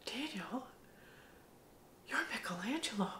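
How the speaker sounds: soft breathy whisper of his name; awed hushed whisper; whispered revelation. Retold or imitated. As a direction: lean in and whisper it like a secret. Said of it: "I walk up to him and I go".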